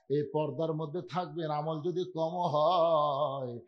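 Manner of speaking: chanted sermon with drawn-out endings; measured waz chant; melodic preaching. Real, not acted. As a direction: intone it, stretching the last syllables. Melodic exhortation to women.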